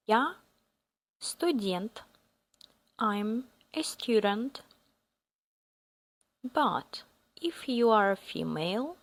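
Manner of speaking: ordinary talk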